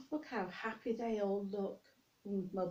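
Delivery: plain reading voice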